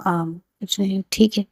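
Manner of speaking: subdued, mildly sulky reply. A narrator's reading. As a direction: brush it off with a soft pout. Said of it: "इतना सड़ा-सड़ा मुँह लेकर बात कर रही हो". (हल्की उदासी में बुझा-सा जवाब)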